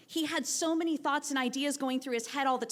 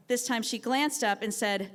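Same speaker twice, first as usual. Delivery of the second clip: ordinary delivery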